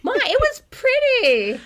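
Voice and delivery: high, loud, drawn-out whine; mock whiny protest. Teased writer protests to co-host, mock whine. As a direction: whine it slowly like an offended kid.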